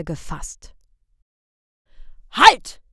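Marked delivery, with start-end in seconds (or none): 2.3-2.8 loud, high bellowed command; loud bellowing stop; bellowed halt. A narrator's reading. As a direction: stop them with a bark. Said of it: "brüllten die Leiter".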